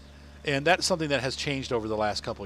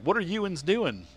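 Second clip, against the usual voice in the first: slow, higher Pennsylvania "youans"; Pennsylvania dialect imitated; 7 semitones higher, slower than usual. Quoting locals asking what's up, imitated Pennsylvania dialect. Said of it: "people in Pennsylvania say you'ns".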